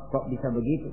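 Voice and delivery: low, soft query; puzzled question. Acted. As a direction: ask quietly how that can be. Ismail questions the devil. (bertanya pelan, heran)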